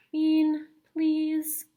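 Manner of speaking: a hint of a tired mother; exasperated mom. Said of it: "said her mother".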